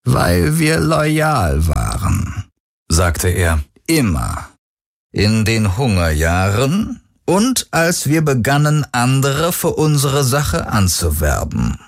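leicht gespielter Vater, höher und langsam; selbstgefälliger Patriarch, getragen erzählt; Stimme des Vaters. A narrator's reading. Vater spricht zur Tochter. (der Vater prahlt vor der Tochter mit seiner Loyalität, höher und langsam)

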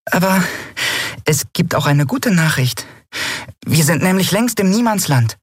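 high, perky little voice; trying to cheer up. A narrator's reading. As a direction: offer good news encouragingly. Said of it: "bemühte sich um einen aufmunternden Tonfall". (bemüht aufmunternd)

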